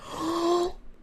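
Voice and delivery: sharp high gasp; excited gasp. Retold or imitated. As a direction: gasp in delight. Overwhelmed gasp.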